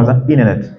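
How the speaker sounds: American "Internet" with the t flapped away, like "inernet"; American accent, drop the t in "Internet"; American accent, "Internet" as "innernet". Shown as a demonstration. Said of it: "lui va dire".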